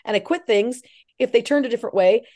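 normal talking voice

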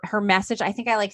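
ordinary talk, nothing marked

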